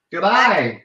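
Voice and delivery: mock Australian greeting; broad Aussie g'day; Australian accent. Showing off to an Australian guest, put-on Australian accent. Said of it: "difference between Australian accent".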